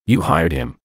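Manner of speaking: ordinary delivery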